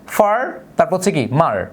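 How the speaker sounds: ফার then মার as separate beats; split into syllables. Shown as a demonstration. Teacher splitting the word for the class into far and mar. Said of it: "দুইবারে করছি কিভাবে".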